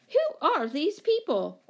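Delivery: high, slow, incredulous question; astonished demand. A narrator's reading. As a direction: demand it, drawing the words out. Woman exclaiming over strangers.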